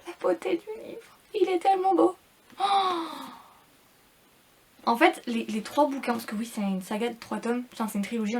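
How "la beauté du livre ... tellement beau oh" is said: aiguë et enthousiaste, puis plus fort; s'extasier devant la beauté du livre; émerveillée. Real, not acted. (émerveillement devant la couverture, voix bien plus aiguë)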